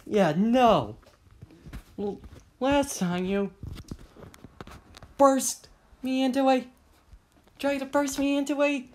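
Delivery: high-pitched, loud protest; high, insistent refusal; defiant retort. Acted. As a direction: shout back while being chased. Acted defiant shout back while chased.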